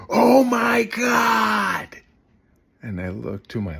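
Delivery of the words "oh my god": high, very loud, stretched-out gasping shout; shocked shriek. Retold or imitated. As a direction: shriek it like you just caught someone red-handed. Stranger shocked at scene.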